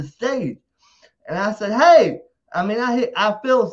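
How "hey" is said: sudden loud shouted hey; much louder, higher shout; retold yell. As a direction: yell it out mid-fall. Yelling while falling off stage.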